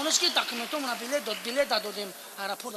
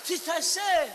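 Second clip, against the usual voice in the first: ordinary talk, nothing marked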